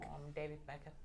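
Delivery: tiny, soft high-voice imitation; mock high voice, barely audible; David Beckham. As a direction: squeak it out very quietly. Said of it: "has actually a super high voice".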